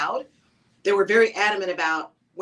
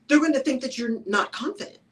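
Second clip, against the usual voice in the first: ordinary talk, nothing marked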